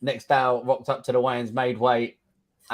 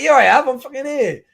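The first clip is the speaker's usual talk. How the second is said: high, loud mock protest; a hammed-up cry of here I am; indignant retold shout; 11 semitones higher, 8 dB louder than usual. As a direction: insist loudly that you've arrived. Retelling showing up late, indignant.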